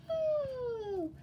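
loud, high drawn-out "oh"; high-pitched cry out of nowhere; sudden high cry. A narrator's reading. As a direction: startle them with a high call. Sudden cry makes everyone jump.

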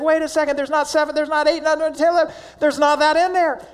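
put-on a kid who can't believe it; excited boy. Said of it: "he gets real excited".

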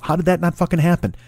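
ordinary delivery

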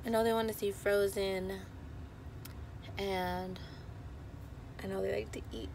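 hoarse, fading voice; raspy whisper. Real, not acted. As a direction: rasp through a lost voice. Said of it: "Sorry, I'm losing my voice".

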